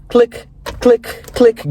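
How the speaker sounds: 1 gun clicks. Retold or imitated. Imitating an empty gun.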